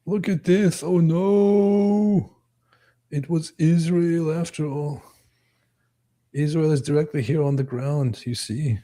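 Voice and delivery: high-pitched put-on voice; silly high voice, drawing out the dismay; mock convinced believer. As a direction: lament the discovery with hammed-up alarm. Plays convinced conspiracy believer.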